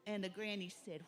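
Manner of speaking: ordinary delivery, nothing marked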